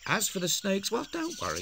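sly teasing lilt, pitch leaping up; a playful, mysterious hint; playful tease. A narrator's reading. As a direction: tease them about the snakes. Teasing about snakes, pitch leap.

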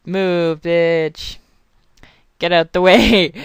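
ordinary delivery

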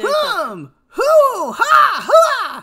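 high, loud cartoon hero exclamations; Naruto. Shown as a demonstration. Streamer showing chat how the character sounds, loud Naruto impression. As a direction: shout out short, high anime-style battle noises. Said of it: "That's the way it sounds like Naruto".

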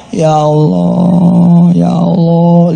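very slow, drawn-out ya Allah; slow drawn plea to God; slow crying out to God. Retold or imitated. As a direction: plead to God, stretching each word. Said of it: "dia teriak Allah saja".

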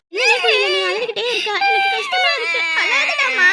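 high, loud, pained pleading; upset and overwhelmed, voice pushed up high; helpless distress. Acted. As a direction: plead helplessly over the baby's crying. Helpless with a baby who keeps crying.

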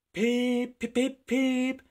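high, loud train-whistle peeps; whistled peeps. A narrator's reading. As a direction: toot like a steam whistle. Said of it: "whistled Edward".

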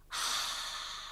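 a soft audible exhale; long breathy sigh. Shown as a demonstration. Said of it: "Big breath out".

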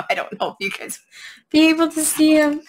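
ordinary talk

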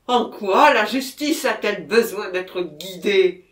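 loud, indignant retort; agitated protest. A narrator's reading. Murderer answering the police commissioner, agitated protest. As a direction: throw the question back forcefully.

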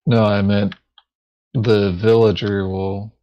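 usual speaking voice

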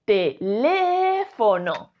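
"teléfono" very slow, LE drawn out; te-LÉÉÉ-fo-no, exaggerated LE; stress on second syllable. Shown as a demonstration. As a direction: hold the LE of "teléfono" long. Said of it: "la manera correcta de pronunciar".

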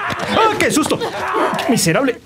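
high startled yelp with a laugh; jump, laugh, then yell them away; startled laugh-shout. Real, not acted. Gamer scared by a clicker, high laughing yell.

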